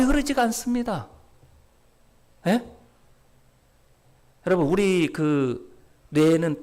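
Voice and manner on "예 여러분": ordinary talk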